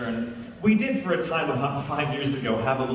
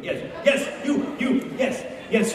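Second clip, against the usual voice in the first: eager singling-out; 9 semitones higher, slower than usual. Real, not acted. Picking someone out of the audience.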